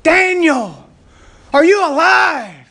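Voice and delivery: high, loud and slow, calling out in despair; king cries out to Daniel, fearing him dead; anguished cry. Acted. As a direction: call out slowly in anguish, voice lifted high. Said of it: "He says in anguish".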